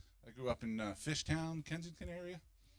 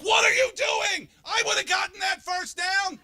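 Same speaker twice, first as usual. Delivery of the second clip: yelling at the TV; 11 dB louder than usual. Retold or imitated. Couch fan screaming at the football team, outraged. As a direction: bellow at the screen like a furious fan. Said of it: "I'll just be sitting on the couch like".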